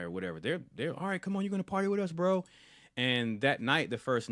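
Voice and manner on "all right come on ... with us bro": high, fast imitation of a welcoming bro; welcoming party guy. Guys at the party welcoming Tyler, hyped and friendly. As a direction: rope the newcomer in, pitched up and quick.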